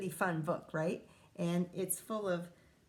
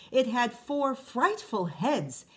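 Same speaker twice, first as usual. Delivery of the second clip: plain reading voice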